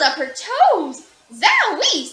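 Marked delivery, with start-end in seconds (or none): 1.3-2.1 high, loud surprised zowie; zowie exclaimed. A narrator's reading. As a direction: recoil at the smell. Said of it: "said Ada".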